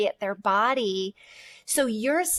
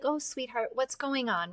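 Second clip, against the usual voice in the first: soft, much quieter, tender parent voice; tender to toddler; 2 semitones higher, 7 dB quieter than usual. Pretend. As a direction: soothe a small child gently. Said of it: "We'd be like".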